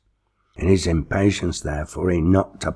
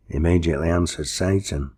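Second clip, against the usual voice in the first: usual speaking voice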